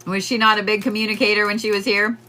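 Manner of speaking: normal talking voice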